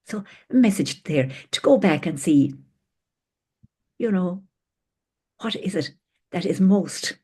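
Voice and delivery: ordinary talk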